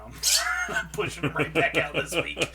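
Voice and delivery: laughing. Pretend. Threatening to shove Casey out.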